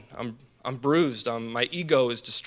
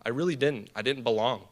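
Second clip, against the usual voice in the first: ordinary talk, nothing marked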